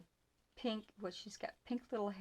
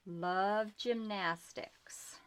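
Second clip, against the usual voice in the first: drawn out slowly and louder; slow emphatic read; 6 dB louder, much slower than usual. Real, not acted. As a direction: read each word slowly. Reading the ribbon text aloud.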